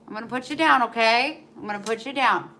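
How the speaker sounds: ordinary talk, nothing marked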